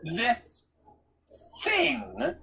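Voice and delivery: pointed warning with a pause between words. Acted. Grover defends the poodle to Percy, warning. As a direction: warn them, word by word. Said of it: "Grover warned".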